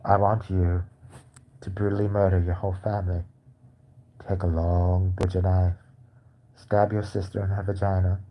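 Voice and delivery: a hint of a soothing ASMR whisperer; sick ASMR voice. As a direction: lull them while saying horrible things. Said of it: "He should do A S M R".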